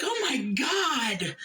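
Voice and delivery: high, slow shocked gasp of a greeter; door greeter gasp. Retold or imitated. Shocked greeter gasping at the mess. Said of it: "she was like".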